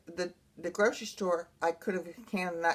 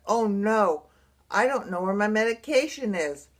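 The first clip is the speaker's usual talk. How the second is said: louder, dismayed mock panic; retold panic; 2 semitones higher, 7 dB louder than usual. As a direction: retell a rising alarm with heavy emphasis. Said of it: "I went into a panic attack".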